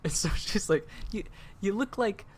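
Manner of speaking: high, loud excited teacher. Retold or imitated.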